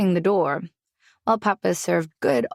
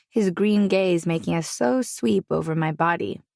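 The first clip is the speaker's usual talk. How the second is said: ordinary delivery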